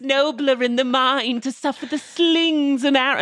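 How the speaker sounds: grand, slow, high theatrical recitation with a laugh; hammed-up Shakespeare. Young actor mocked playing Hamlet, slow hammy declaiming. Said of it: "All right, I'm gonna do Hamlet".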